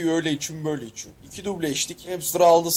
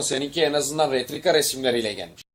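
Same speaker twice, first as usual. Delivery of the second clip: usual speaking voice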